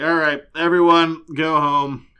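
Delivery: loud call to a crowd. Acted. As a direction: call out to everyone. Buffy calls out to crowd.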